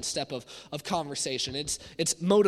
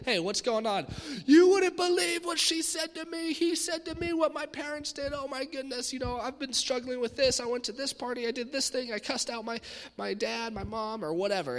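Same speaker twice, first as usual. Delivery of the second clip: venting friend; 8 semitones higher than usual. Acted. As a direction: play a teen pouring out their troubles. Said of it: "It starts with saying".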